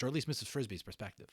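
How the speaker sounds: usual speaking voice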